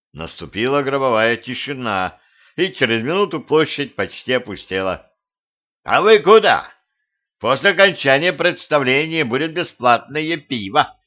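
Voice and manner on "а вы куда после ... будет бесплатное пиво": high, loud call; shouting after crowd. A narrator's reading. King to the scattering crowd, shouting after them. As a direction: call out to people running off. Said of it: "крикнул король, разбегающийся в разные стороны толпе".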